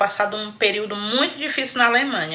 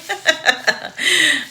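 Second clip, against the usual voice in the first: 1 laugh; 6 semitones higher than usual. Real, not acted. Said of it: "eu tô rindo aqui".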